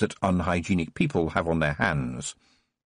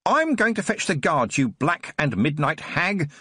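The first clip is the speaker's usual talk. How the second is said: screaming; 6 semitones higher than usual. Acted. Nurse screams at Tiffany while heading for the door. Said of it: "the nurse screamed".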